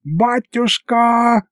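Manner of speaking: very high, drawn-out slow cry; wail of joy. A narrator's reading. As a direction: howl it out with relief. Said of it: "завопил Михалыч".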